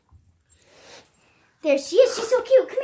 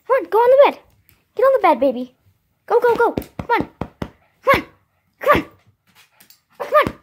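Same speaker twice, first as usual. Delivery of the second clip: high, louder coaxing calls; excited coaxing; 6 semitones higher, 5 dB louder, much faster than usual. Real, not acted. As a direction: urge the dog up eagerly. Coaxing dog onto bed.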